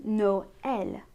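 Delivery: Noël pulled apart as "no... el"; two syllables. Shown as a demonstration. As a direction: say Noël slowly, vowels separated. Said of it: "make them two different syllables".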